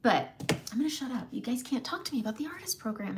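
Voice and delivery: usual speaking voice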